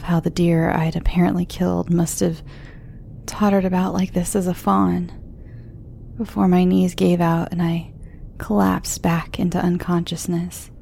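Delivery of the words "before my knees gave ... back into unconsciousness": ordinary delivery, nothing marked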